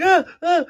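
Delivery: high, loud startled yelps; brother's scared yelps. Retold or imitated. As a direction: yelp like a frightened little brother. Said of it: "My brother's getting like".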